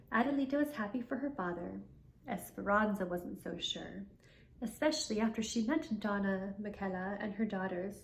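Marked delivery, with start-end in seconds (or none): none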